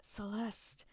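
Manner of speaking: husky, half-whispered name; trial character voice. Shown as a demonstration. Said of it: "gonna try all different voices".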